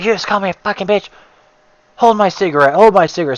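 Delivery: high mock woman telling husband to hold her cigarette; a lightly voiced outraged neighbor lady; angry woman. Neighbor woman squaring up, handing her husband a cigarette, mock outraged. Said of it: "she's like".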